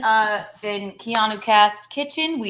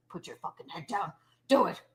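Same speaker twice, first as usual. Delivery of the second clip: mock head chef; 2 semitones lower, 7 dB quieter, faster than usual. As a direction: pretend chef giving stern orders. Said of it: "This is what they would do in The Bear".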